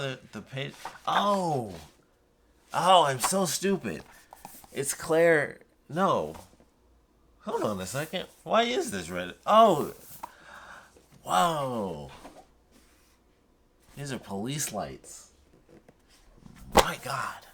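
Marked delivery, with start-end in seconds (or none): 1.1-9.9 surprised. Real, not acted. Realizes the art shows Claire. 11.2-17.5 breathless whisper of amazement; awed whisper. Real, not acted. Awed by police lights on box.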